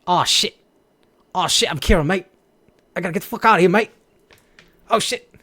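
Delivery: a panicked Aussie on the run; Australian accent. Pretend. Streamer hamming up a fleeing Australian, loud and high. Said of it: "Is that Australian?".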